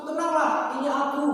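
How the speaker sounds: high, loud Tenanglah, ini aku; Jesus calming. Acted. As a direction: call out to calm them at once. Jesus answers immediately. (seruan menenangkan yang keras)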